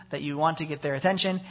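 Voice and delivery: ordinary talk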